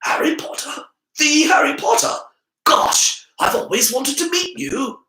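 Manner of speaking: high, loud starstruck gush; bright enthusiasm. A narrator's reading. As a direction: squeal like a fan. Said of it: "with bright enthusiasm".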